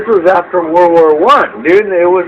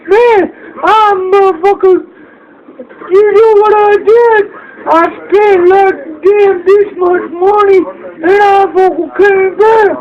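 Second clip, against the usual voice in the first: much higher, slow, hammed-up fake crying; mock sobbing gambler; 4 dB louder than usual. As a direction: wail it like a broke gambler crying over lost money. Gamblers back from Vegas, broke.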